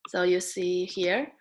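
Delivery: ordinary talk, nothing marked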